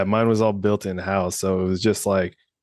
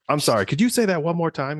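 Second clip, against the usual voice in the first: higher, overly polite mock request; cheesy skit delivery, pitched up; corny training-video actor; 6 semitones higher than usual. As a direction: ham up asking for a repeat. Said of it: "it's like the corny type of thing".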